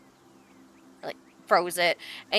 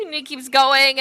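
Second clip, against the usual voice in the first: ordinary talk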